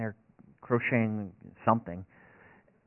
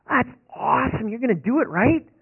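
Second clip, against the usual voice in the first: much higher and louder, thrilled wife; excited wife; 13 semitones higher, 9 dB louder than usual. Retold or imitated. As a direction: voice the wife's delighted cheer.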